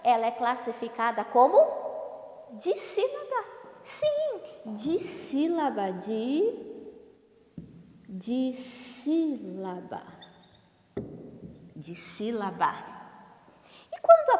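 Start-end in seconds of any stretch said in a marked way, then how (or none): 2.5-4.4 very high little pupil voice; pupil's answer. Acted. Pupil answers teacher's question about syllables, high put-on voice. 4.7-10.0 DISSÍLABA slowly broken into di-sí-la-ba; syllable by syllable. Shown as a demonstration. Teacher repeats the term for pupils, "dissílaba" syllable by syllable. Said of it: "Se a palavra tem duas sílabas".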